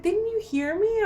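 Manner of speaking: high mock-whiny reproach; retold plaintive complaint. Complaining to sleeping partner.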